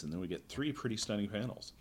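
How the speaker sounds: normal talking voice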